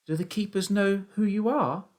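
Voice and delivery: put-on high boyish voice; worried young son. A narrator's reading. Son asks trapped father about keepers, worried. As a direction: ask anxiously in a high child's voice.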